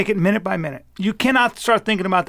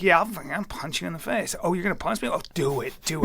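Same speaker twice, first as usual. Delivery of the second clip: hushed mock threats, softer; under the breath; 3 semitones lower, 7 dB quieter than usual. Couple trading threats quietly so kids won't hear. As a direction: keep it down so the kids can't hear. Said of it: "under our breath so the kids couldn't hear".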